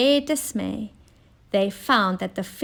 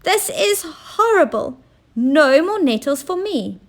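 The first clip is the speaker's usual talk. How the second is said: higher, disgusted complaint; high-pitched fussy queen wailing; queen whining after the sting; upset queen; 7 semitones higher, 4 dB louder than usual. Acted. Queen stung by nettles.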